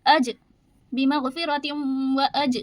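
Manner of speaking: अज with the ज bounced, a short echo after it; अज with qalqala. Shown as a demonstration. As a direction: make the ज in अज rebound. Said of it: "ये यहाँ पे कलकला करेंगे".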